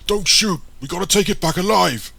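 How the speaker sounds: loud, high urgent command; full-force alarmed yell; shouted order. A narrator's reading. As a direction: shout to stop the others firing. Soldier orders panicking comrades.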